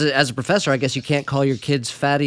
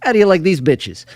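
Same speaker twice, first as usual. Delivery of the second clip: a tough New York guy flexing, louder and higher; New York accent; 7 semitones higher, 4 dB louder than usual. Pretend. Host flexing his arms for the guests, in a New York accent. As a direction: show off the biceps in a brash New York accent. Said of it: "what was that accent?".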